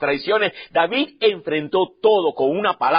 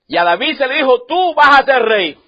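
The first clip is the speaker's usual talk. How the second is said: preaching proclaim; 6 semitones higher, 6 dB louder than usual. Acted. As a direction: declare it to the church. Preacher repeating promise loudly.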